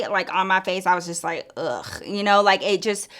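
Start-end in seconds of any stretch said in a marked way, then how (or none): 1.6-1.9 low, soft disgusted groan; disgusted ugh. Retold or imitated. Seeing the creep with her friend, low disgusted ugh. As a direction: let the disgust show.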